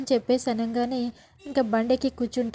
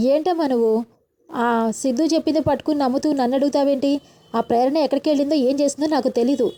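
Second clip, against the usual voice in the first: angry retort; 2 semitones higher, 9 dB louder than usual. A narrator's reading. Son shouts back at mother.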